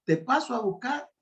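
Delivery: lightly voiced son, pleased; quoting son. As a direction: repeat the son's offer brightly. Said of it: "Me dice".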